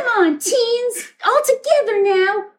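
high, drawn-out hammed-up rally call; rallying cry. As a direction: urge the teens into a group heave. Rallying teens against the monster.

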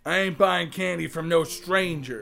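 normal talking voice

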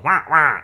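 Penguin squawk. Retold or imitated. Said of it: "Colin Farrell to go".

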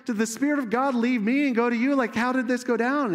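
high, loud incredulous questioning; mock indignant challenge. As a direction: confront him like an affronted rival. Acts out rival prophet challenging Micaiah.

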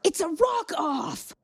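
high, drawn-out shout; stretched, high-pitched exclamation; excited kid. Acted. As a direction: cry it out like an announcement of battle. Other player voices excited kid.

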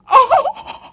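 high, loud gasped oh; stunned realisation; mock shocked oh. Shocked at eleven grand.

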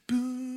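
high boom left to ring out; sustained note. Shown as a demonstration. Showing the sustain pedal, high ringing boom. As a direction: imitate a piano note held by the pedal. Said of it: "It carries out".

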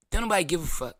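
ordinary talk, nothing marked

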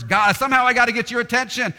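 high, loud and fast pleading; desperate cry for God's attention; hammed-up anxious worshipper; frantic pagan prayer.

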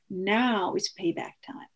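slow, hushed ominous whisper; quoted friend whispering a threat. Retold or imitated. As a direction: breathe it like a dark warning.

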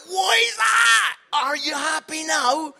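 high, loud, slow and incredulous; incredulous shout. Retold or imitated. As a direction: shout it in disbelief, stretching it out. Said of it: "It's like".

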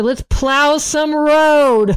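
slow, high rallying call; mock battle cry. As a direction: drag it out like a movie president's order. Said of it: "he says it exactly that same way".

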